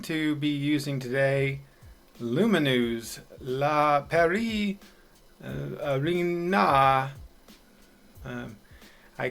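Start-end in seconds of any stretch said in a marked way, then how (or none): 2.2-7.1 put-on slow, careful stab at the French name in a French accent; half-hearted French accent. Host reading out the French arena's name, a slow half-hearted French accent. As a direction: ease through the French name with a hint of a French accent. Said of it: "I'm not going to attempt to do a French accent".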